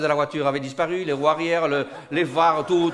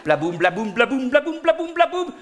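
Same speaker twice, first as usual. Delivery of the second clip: usual speaking voice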